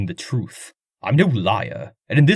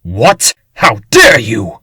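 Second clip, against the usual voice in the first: sharp, loud affronted "How dare you?"; indignant outrage; 10 dB louder, slower than usual. Acted. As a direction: bristle at the insult, voice raised. Accused of murder, he bristles.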